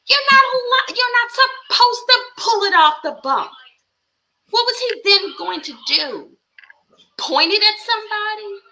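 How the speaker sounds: loud, high, incredulous rant; high-pitched berating; exasperated scolding. Acted. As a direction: holler it in disbelief at the stupidity. Loud mock scolding continues.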